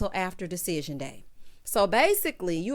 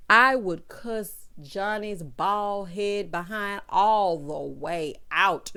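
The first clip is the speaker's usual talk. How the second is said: very slow, each word stretched; drawn-out emphatic declaration; 2 semitones lower, much slower than usual. Real, not acted. Declaring how she'd cuss Johnny out, slow and emphatic. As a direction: drag out every word for emphasis.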